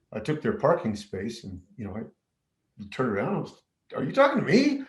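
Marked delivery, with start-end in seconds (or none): 4.0-4.8 high, startled "are you talking to me"; mock-startled, pitched up; surprised retort. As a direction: toss back a high surprised challenge. Retold parking lot confrontation.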